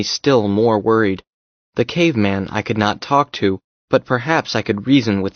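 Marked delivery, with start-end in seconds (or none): none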